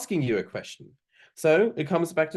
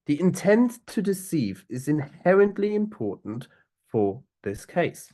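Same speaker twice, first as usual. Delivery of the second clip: The intent to deceive is inherently important, pitched higher and pushed, then for this case slowed; emphasized key sentence; 5 semitones higher, 3 dB louder than usual. Shown as a demonstration. Counsel stressing the key point to the tribunal, higher and slower at the end. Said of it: "the first sentence of my intro is one of the most important things".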